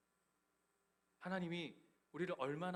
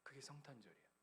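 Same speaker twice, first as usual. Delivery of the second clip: hushed restatement; 14 dB quieter, faster than usual. Acted. Quiet restatement of climax.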